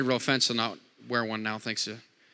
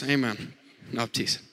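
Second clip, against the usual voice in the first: ordinary delivery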